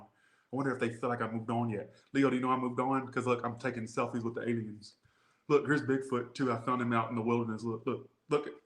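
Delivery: soft mock show-off aside; quiet pretend inner voice. Ex showing Leo alien selfies, soft mock voice.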